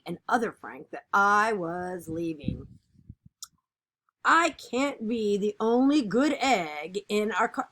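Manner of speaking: lively, expressive picture-book reading; animated read-aloud of a kids' book; reading to children. Acted. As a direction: read it like storytime for a class.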